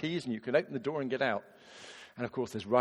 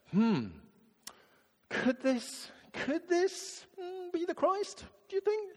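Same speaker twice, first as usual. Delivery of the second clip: a dithering, unsure hmm; doubtful musing; slower than usual. Shown as a demonstration. Samaritan woman wondering aloud, doubtful high ponder. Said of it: "I don't think she's saying".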